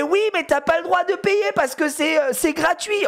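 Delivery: put-on high, frantic child voice; put-on high-pitched protest; muddled, upset little girl. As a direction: imitate a kid arguing herself into knots. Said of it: "elle se mélange les pinceaux".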